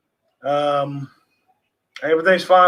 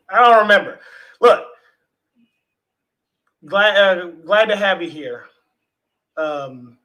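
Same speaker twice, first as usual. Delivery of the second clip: ordinary talk, nothing marked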